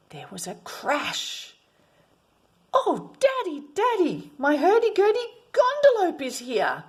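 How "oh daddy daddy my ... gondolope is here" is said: put-on excited child. Boy thrilled at his birthday pet.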